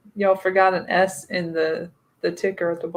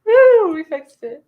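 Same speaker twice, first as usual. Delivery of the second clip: loud, high, slow woo; excited cheer; 9 semitones higher, 9 dB louder, slower than usual. Real, not acted. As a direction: cheer the fix. Celebrating the fixed typo.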